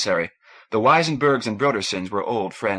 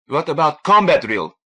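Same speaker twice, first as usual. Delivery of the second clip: loud, higher demand; 5 semitones higher, 7 dB louder than usual. A narrator's reading. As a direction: press the question hard and loud. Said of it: "he demanded".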